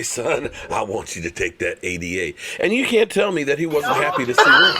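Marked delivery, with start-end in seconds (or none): 0.0-2.3 lightly voiced proud team owner, lower and softer; a hint of the proud owner talking to his new pick; Jerry Jones. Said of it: "proud to go ahead and say".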